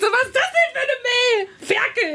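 high, shocked squeal of mock disgust; mock outraged shriek. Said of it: "Ich so".

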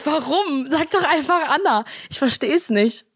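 exasperated. Acted. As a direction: beg them to just say it. Same plea, repeated in exasperation.

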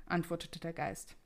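usual speaking voice

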